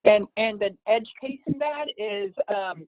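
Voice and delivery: thin, distant phone line; talking over a cell phone. Real, not acted. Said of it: "it sounds like you're, you're on a wireless speakerphone".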